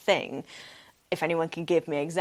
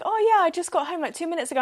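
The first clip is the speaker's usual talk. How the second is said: high, chirpy friend voice; breezy friend; 10 semitones higher, 4 dB louder, much faster than usual. Retold or imitated. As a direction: bounce it back like a cheery friend. Friend answers cheerfully.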